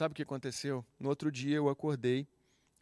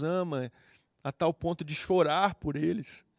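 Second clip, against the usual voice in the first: loud, higher, impassioned pulpit voice; preaching at full force; 3 semitones higher, 6 dB louder than usual. Acted. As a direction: proclaim it to the whole church. (pregação inflamada, bem alta e aguda)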